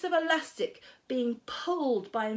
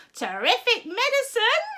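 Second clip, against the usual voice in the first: much higher, louder cry of glee; delighted cry; 12 semitones higher, 6 dB louder than usual. A narrator's reading. Grandma praising the medicine. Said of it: "she cried".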